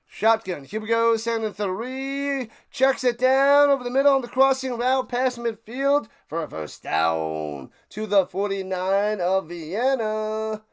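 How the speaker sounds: sports commentator in full flow; live play-by-play. Pretend. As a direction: call the play with building excitement. Calls the game play live.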